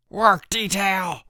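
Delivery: high, slow, loud bellow. A narrator's reading. Counselor bellowing punishment at camper. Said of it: "bellowed Chip".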